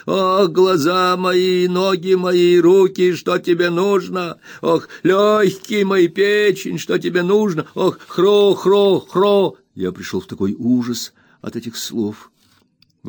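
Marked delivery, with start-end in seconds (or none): 0.0-9.6 put-on high, slow, whining old miser's wail; much higher, drawn-out spiteful whimper; spiteful whining old man. A narrator's reading. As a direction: whine it out spitefully like a crazed old junk dealer. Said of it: "каждую фразу он произносил нараспев".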